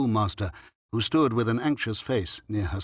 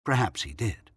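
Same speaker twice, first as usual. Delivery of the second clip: plain reading voice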